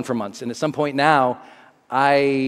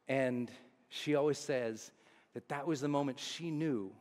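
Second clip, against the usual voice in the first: much softer, lower reminiscence; tender hush; 4 semitones lower, 16 dB quieter than usual. Real, not acted. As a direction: soften as you recall the moment. Tender romantic memory.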